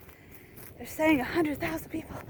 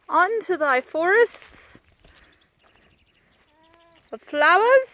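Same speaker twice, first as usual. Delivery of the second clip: mock poetic; 4 semitones higher, 6 dB louder than usual.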